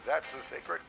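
usual speaking voice

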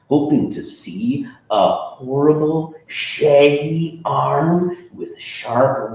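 ordinary delivery, nothing marked

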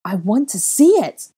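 loud high scream. A narrator's reading. Girl screaming at the retreating boy, loud high. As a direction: scream it at him. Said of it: "I screamed".

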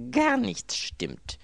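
high, loud, drawn-out wail; exasperated outburst. A narrator's reading. Robbed man answering his servant's question, exasperated. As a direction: stretch it out in bitter despair.

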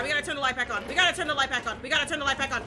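ordinary talk

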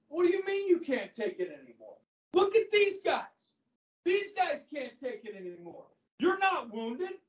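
scornful outburst, spiking on look at these guys; teacher acting out Patton berating the weeping soldier; retold berating. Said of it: "Patton just starts berating him".

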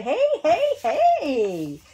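very high, loud startled hey; calling out. A narrator's reading. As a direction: call up to the treetop in protest. Children call up to tree.

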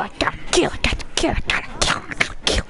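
repeated unvoiced whispered brag; giddy whispered chant; whispered glee. Real, not acted. Gloating over a kill.

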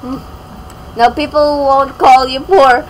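usual speaking voice

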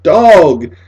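loud joyful shout; boy hugging his dog, shouting; laughing shout. A narrator's reading. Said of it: "He laughed and shouted".

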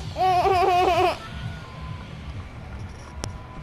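high laugh in a put-on voice; giggling break. Real, not acted. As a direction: laugh at his own joke. Laughs at own bit.

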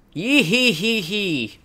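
slow, put-on cackling laugh; witch cackle. Witch laughing at the cruel young men, slow cackle.

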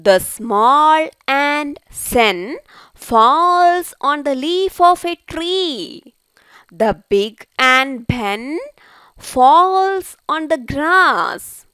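slow, drawn-out storybook reading; slow read-aloud to children. Acted. Teacher reads the ant story to pupils, slow and deliberate. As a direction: read it very slowly for young learners.

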